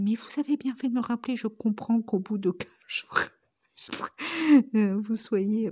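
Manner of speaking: high, loud, half-laughing quote; imitating the caller, laughing; mock high official voice. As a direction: giggle through a prim impression. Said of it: "je rigole pour rien".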